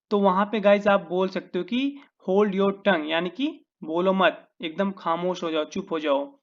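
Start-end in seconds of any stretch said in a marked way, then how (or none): none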